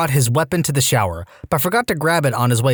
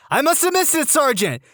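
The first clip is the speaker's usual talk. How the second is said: nervous recruit; 11 semitones higher than usual. Retold or imitated. Recruit answers sergeant.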